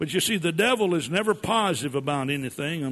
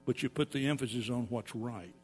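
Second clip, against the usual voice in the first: "you put" said lower and quieter; preacher easing into the good news, softer; softer answer; 4 semitones lower, 8 dB quieter, faster than usual. Shown as a demonstration. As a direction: let the right way land softly.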